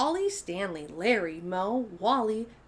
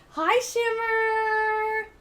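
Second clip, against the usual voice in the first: high, slow, stretched-out hello; drawn-out cheery hi; long bright call to a friend; sing-song greeting; 11 semitones higher, 6 dB louder, much slower than usual. Acted. Children's show greeting call.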